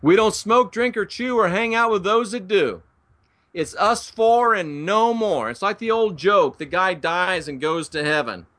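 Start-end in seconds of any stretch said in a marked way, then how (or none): 0.0-5.5 higher, smug sing-song voice; a lightly hammed-up pious believer; mocking churchgoers. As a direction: mimic the self-righteous faithful. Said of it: "followers of Paul are still saying this today".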